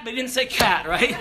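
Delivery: normal talking voice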